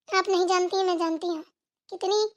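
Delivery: ordinary talk, nothing marked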